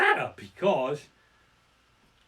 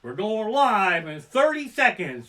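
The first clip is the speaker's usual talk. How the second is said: staff announcement; 6 semitones higher, 8 dB louder, slower than usual. Retold or imitated. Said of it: "They say".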